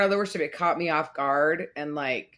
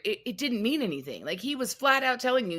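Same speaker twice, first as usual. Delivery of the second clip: ordinary talk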